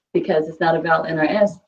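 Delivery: ordinary delivery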